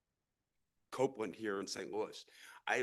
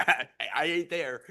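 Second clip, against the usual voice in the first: laughs it off, louder and slower; chuckling admission, a real laugh through the words; 5 semitones higher, 10 dB louder than usual. Real, not acted. To the Ferguson crowd, laughing at himself. Jokes he isn't a billionaire.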